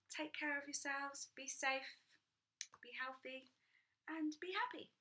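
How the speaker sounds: ordinary delivery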